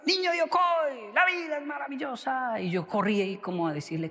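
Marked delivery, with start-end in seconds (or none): 0.0-2.6 high, slow, drawn-out call across the station; imitated shout. Teacher spotting his old student at the station, calling out high and slow. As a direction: call out to a former student with delight. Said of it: "él gritó".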